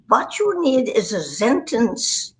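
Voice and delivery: put-on slow, instructive German accent. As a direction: coach someone in a stagey German accent. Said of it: "I could do a German accent".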